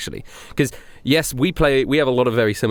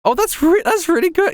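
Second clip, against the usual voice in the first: excited; 3 dB louder than usual. Real, not acted. Excited reaction to pick.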